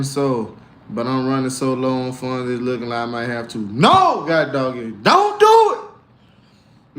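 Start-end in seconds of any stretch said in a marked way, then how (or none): 5.0-7.0 very high, slow, stretched-out plea; high drawn-out plea. Acted. Talking himself out of selling, a high drawn-out plea. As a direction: beg it out long and high like a falsetto cry. Pleading with himself not to sell.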